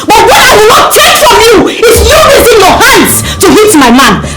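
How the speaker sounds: high, fast, shouted tirade; angry warning. Acted. Warning a rival off her man, angry. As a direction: rush the warning out at full heat.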